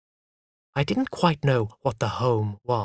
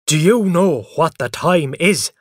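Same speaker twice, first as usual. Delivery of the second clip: loud, raised, indignant demand; angry; 4 semitones higher, 6 dB louder than usual. A narrator's reading. Father woken early, angrily demanding of mother. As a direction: snap at being woken too early. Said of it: "he cried angrily".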